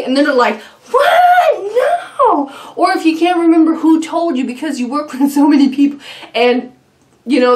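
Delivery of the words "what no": much higher, louder shocked What? No!; shocked shout. Retold or imitated. Imitating coworker denying the instructions, laughing shocked shout.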